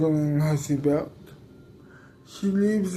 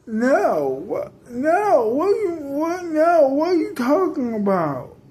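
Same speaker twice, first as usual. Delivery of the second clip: shocked protest; 8 semitones higher than usual. Acted. Shocked refusal, disbelieving outbursts.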